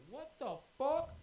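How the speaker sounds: high, loud, drawn-out disbelief; retold shock at exploding; shocked yell. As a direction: shout it slowly in shock. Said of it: "you just hear me go".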